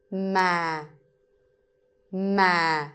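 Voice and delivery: ma said low and falling; huyền tone on ma, low falling; Vietnamese low tone. Shown as a demonstration. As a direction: let ma sink low. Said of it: "the line is telling you to go low".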